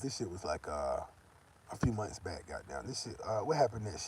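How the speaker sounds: muttered aside. Real, not acted. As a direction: mumble while trying to remember.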